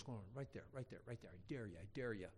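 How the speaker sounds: low, hushed goading; a brother daring under his breath; quiet taunting. Retold or imitated. Brother taunting him into a punch.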